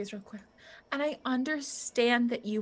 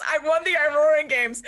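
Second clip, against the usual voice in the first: louder laughing parody of Troilus; laughing mock quote; 3 semitones higher, 7 dB louder than usual. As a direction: quote him while cracking up. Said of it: "I do genuinely think it's".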